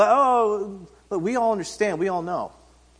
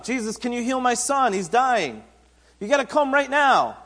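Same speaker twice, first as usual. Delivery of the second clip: urgent plea; 6 semitones higher, 3 dB louder than usual. Retold or imitated. Official pleading with Jesus.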